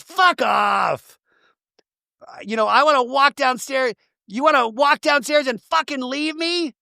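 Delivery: a hammed-up high, angry outburst; mock angry rant. Retelling the fight on the boat, a mock furious outburst. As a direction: snap it out like a fed-up friend. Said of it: "And then it goes".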